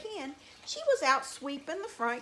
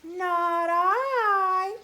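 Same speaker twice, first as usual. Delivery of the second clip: high, loud meowing whine; whiny cat; 6 semitones higher, 8 dB louder than usual. A narrator's reading. Cat refusing to help the hen, high whiny voice. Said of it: "said the cat".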